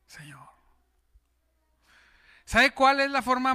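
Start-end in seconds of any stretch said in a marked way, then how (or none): 0.1-0.5 breathy whispered aside to God; hushed whisper; whispered prayer. Real, not acted. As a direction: breathe the word to the Lord.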